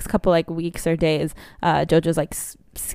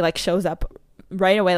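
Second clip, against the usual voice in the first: ordinary talk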